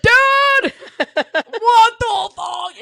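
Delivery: high, loud, laughing disbelief; outraged cry to dad. Retold or imitated. Calling her dad after the date, loud laughing outrage. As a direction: wail it at dad, half laughing.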